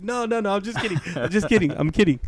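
high, hurried taking it back; mock-frightened retraction, easing down as it goes; panicked backpedalling. Backpedalling after nurse threatens pain.

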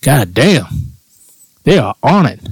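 normal talking voice

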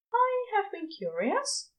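admitting. A narrator's reading. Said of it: "Aziraphale admits".

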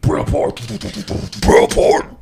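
loud breathy hiss of the name; whispered shout. Acted. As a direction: rasp it out hard. Repeating the band name.

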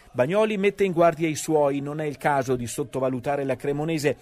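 normal talking voice

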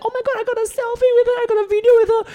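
high, loud, rushed fan squeal; excited shouting. Retold or imitated. Friend coming out after meeting [name], excited shouting. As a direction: gush it out fast and very high. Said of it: "she came out shouting".

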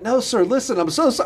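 high-pitched begging; high pleading. Retold or imitated. Boy begging the coach for mercy, high-pitched. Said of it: "getting laughable almost".

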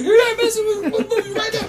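very high, loud, laughing disbelief; excited disbelief. Retold or imitated. Tone hearing the rapper play his favorite song, high laughing disbelief. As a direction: squeal it like a fan who can't believe it.

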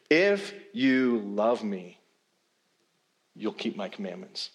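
tender reading. Shown as a demonstration. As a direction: read it as a loving invitation, not a demand. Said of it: "the emphasis is on the relationship".

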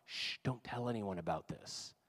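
acted hush, very softly; hushed secrecy. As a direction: shush them and keep it secret. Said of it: "he decides instead to be quiet".

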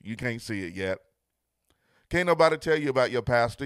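loud, rising preacher's cadence; full-force preaching. Acted. Pastor driving home love is blind to his viewers, loud preaching. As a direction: preach it with building fire.

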